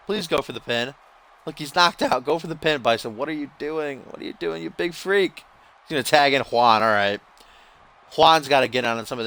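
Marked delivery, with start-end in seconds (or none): none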